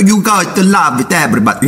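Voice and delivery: high, jolly mock Pope blessing; Pope signing off. Pope ending his sermon to everybody, high jolly voice. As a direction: sing out the sermon's closing line. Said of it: "that's how the Pope ends every, uh, sermon".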